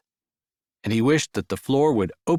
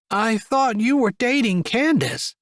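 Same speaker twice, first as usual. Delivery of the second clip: nosy aunt; 10 semitones higher than usual. Acted. Aunt voiced.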